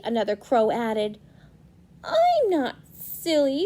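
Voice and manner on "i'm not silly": protesting scarecrow. Acted.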